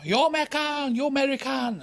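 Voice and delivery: high, pushy hawker's call; shouting madam. Retold or imitated. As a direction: call out like a street madam touting. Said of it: "This, uh, madam was shouting".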